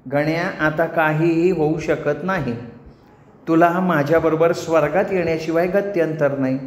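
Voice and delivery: silky, deliberate ultimatum from a nymph; relentless apsara. Acted. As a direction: tell him sweetly there is no escape. Menaka gives ultimatum. (ठाम पण गोड अप्सरा)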